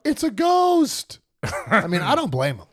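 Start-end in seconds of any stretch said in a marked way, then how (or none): 0.0-1.2 high, loud, drawn-out cry of fright; fearful cry. Retold or imitated. Disciples seeing Jesus on the water, fearful cry. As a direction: cry out in sudden terror, stretching the last word. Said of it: "in their fear, they cried out".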